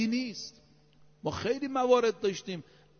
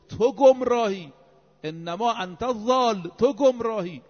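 emphatic retort; 3 semitones higher, 6 dB louder than usual. Retold or imitated. Imam answers the brother who accused him, emphatic retort.

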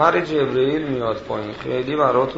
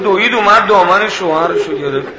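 ordinary talk

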